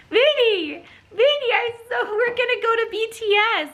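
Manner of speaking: high, giddy singsong to a pet; so excited. Real, not acted. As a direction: squeal the good news to your dog. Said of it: "I'm so excited".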